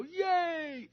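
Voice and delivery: high squealing crowd cheer, mocking; mindless cheer. Mocking the cheering audience at a political speech, high squealing cheer. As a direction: cheer like a brainless fan. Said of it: "and they go".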